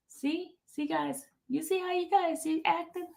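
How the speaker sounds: high, soft teasing; high, hushed gloating; teasing told-you-so. Pretend. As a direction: gloat softly at the chat. Teasing chat after proving point.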